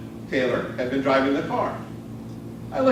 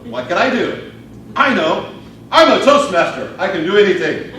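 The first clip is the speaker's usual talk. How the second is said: rising, louder burst of can-do pride; excited self-rally; 5 semitones higher, 6 dB louder than usual. Acted. Speaker on the tracks rallies himself, proud Toastmaster, excited shout. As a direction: pump yourself up like a hero.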